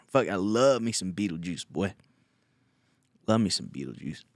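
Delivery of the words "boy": ordinary talk, nothing marked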